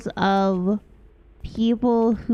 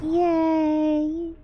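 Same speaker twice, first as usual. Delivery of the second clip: bright happy little yay, a bit higher; happy cheer; 6 semitones higher than usual. Real, not acted. Streamer cheering again at success, happy. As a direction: cheer for yourself. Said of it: "That sounds just very happy".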